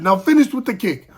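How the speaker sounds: retold coach's urging; coach calling orders.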